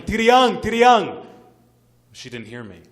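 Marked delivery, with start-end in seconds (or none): 0.0-1.3 very loud, high-pitched call; acted father's full-force shout; retold desperate yelling. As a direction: scream the name across a distance. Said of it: "I started yelling".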